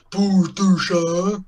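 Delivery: slow dopey giant voice; dopey cartoon bellow. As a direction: drag it out like a dim giant. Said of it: "And the in giant says".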